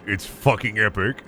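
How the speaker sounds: ordinary talk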